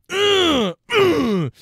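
loud high grunt sliding down; short, high-pitched thrusting grunts; mock exertion grunt. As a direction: grunt it out like a bump. Miming bumping the umpire.

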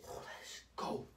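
whispered cheer; soft, breath-only hype; hushed celebration. Retold or imitated. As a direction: whisper it as a secret victory. Celebrates mom's permission.

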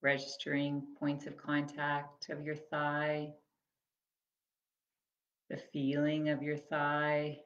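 measured, spacious phrases that leave room to breathe; guided body scan meditation. Acted. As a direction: guide them gently through the body, unhurried.

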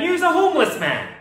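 ordinary talk

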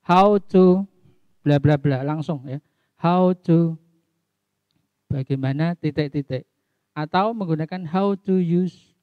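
ordinary delivery

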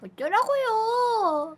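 high, slow sassy whine; high whiny taunt. Acted. Retort again, louder higher.

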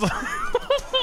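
real giggle going up; high laugh. Real, not acted. Caster laughing at his dad impression, high giggle.

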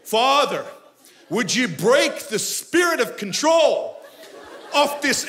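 mock-noble slow prayer. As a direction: pray like a grand noble. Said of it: "like, very noble".

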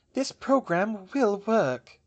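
slow, high, strained insistence; weakly. A narrator's reading. Drained professor defending her failing program, weakly. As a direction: insist slowly, with little conviction left. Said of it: "she said weakly".

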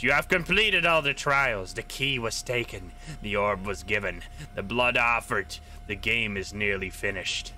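low, slow sinister creature voice. Acted. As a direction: menace it out slowly and low. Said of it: "It starts to talk in a sinister voice".

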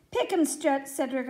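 plain reading voice

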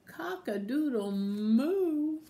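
high, slow, drawn-out cow call; cow's crow. Acted. Cow crowing to the sleeping cows, high and slow. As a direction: crow it high with a long moo. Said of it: "then the cow went".

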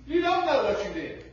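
high, loud burst of correction; emphatic rebuke. Acted. Preacher rebutting the expectation that spouses just know, emphatic rebuke. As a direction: push it out high and forceful.

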